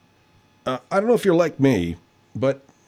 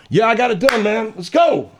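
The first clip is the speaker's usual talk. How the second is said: proud caller; 10 semitones higher than usual. Retold or imitated. Caller back to own voice, triumphant.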